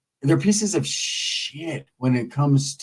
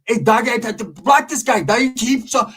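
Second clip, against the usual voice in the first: panicked Big Calvin; 7 semitones higher than usual. Retold or imitated. Mocking Big Calvin panicking live.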